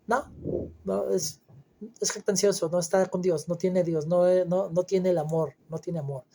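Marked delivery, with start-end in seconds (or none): none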